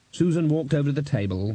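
plain reading voice

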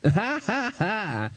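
high, anxious little ha-ha; thin, uneasy forced laughter; nervous laugh. A narrator's reading. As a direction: laugh nervously to placate the boss. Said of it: "said the bursar nervously".

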